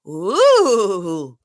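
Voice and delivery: loud drawn-out swooning "ooh"; exaggerated woozy whoop, swelling loud; mock swoon. Showing how Sutton went just before falling over, mock swoon.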